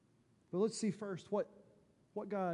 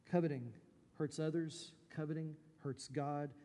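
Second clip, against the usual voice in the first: ordinary talk, nothing marked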